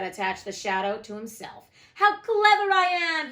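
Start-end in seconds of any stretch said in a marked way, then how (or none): 1.9-3.3 higher, louder and slow, crowing with delight; crowing rapturously. A narrator's reading. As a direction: crow it out, thrilled with himself. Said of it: "he crowed rapturously".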